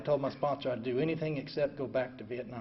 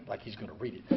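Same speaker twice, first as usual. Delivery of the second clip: ordinary delivery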